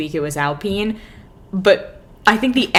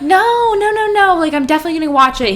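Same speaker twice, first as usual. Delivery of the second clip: much higher, fast, louder no-no-no; panicked refusal; 9 semitones higher, 4 dB louder than usual. Retold or imitated. Refusing the manager's spoiler, a frantic high rush. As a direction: rush to stop him spoiling it. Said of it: "And I'm like".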